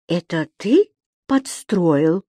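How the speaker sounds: удивлённо. A narrator's reading. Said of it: "спросил господин Дауме, с удивлением".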